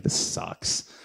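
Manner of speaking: sulking, ignored, whispering to himself; whispered gripe. Retold or imitated. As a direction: grumble it under your breath. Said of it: "And I was like".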